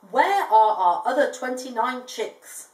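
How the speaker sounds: mother emu confronting her mate, demanding. Acted. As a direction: demand an answer slowly like a cross mother emu. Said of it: "Sheila demanded".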